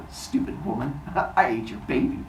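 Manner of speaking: soft, chuckling mock villain; hushed, smug fake laughter; gloating villain chuckle. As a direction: gloat quietly through a put-on laugh. Cronus gloats at fooled wife.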